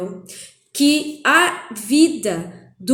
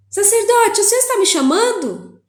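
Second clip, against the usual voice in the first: put-on young Samuel asking; 10 semitones higher, 5 dB louder than usual. Boy Samuel asking the priest.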